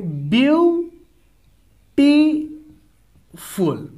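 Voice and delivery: Beautiful spoken very slowly with a gap between each syllable; split into syllables. Shown as a demonstration. Teacher showing students syllable division, slow and split apart. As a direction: spell out beautiful beat by beat, slow. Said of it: "beautiful को अगर मुझे break करना है".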